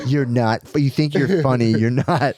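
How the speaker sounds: ordinary delivery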